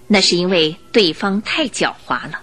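normal talking voice

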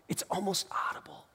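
breath-only, lingering hush; hushed whisper. Real, not acted. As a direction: whisper it as if barely audible. Hushes to evoke imagined voice.

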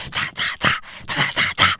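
whispered ta ta ta punches; punching sound effects. Retold or imitated. Father mimics baby sparring with sleep, whispered jabs. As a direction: breathe out quick little jab sounds.

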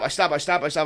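ordinary talk, nothing marked

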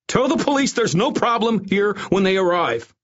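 high, strained frantic order; screaming. A narrator's reading. Doctor orders guards to put off police, screaming. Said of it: "Fabio screamed".

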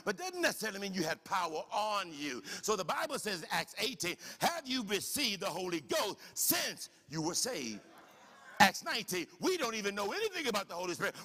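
fired-up pulpit preaching; full-force preaching. Acted. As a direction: preach it out to the congregation.

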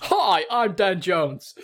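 comic Somerset accent on request. Shown as a demonstration. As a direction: draw out a hammed-up West Country hello. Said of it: "best Somerset accent I've ever heard".

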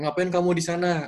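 mock-strict telling off; stern disciplinarian. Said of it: "gue bilang kayak".

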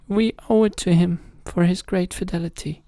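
high, resolved queen voice; brave queen. A narrator's reading. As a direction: agree despite fear. Queen agrees, afraid.

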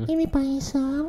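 put-on high, soft, slow child voice; little Faisal voice. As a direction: squeak it out like a small boy. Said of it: "Faisal kecil". (suara anak kecil yang tinggi dan pelan)

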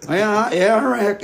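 high, loud Southern twang; put-on Southern accent. Brother slipping into accent with sister, loud Southern twang. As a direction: break suddenly into a Southern accent. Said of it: "for no fucking reason".

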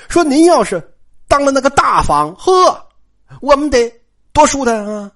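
high, effusive servant's praise; fawning, excited wishful talk; gushing flattery. A narrator's reading. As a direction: flatter eagerly, pitched well up. Servant flatters the second wife.

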